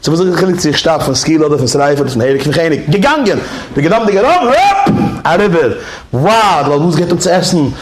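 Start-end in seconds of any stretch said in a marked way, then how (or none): 3.8-5.8 high, rising commands up to the "hopp"; circus command. Retold or imitated. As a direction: drive the lion to jump.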